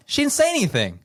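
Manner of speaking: very high, loud squawk of disbelief; exasperated comeback, pitch shooting way up; incredulous outburst. Retold or imitated. Retold retort to online cheering.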